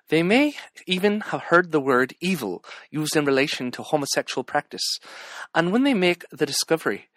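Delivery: put-on quoting an Irish president in a lilting Irish accent. Vlogger quoting an Irish president, in an Irish accent. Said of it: "say it in an Irish accent".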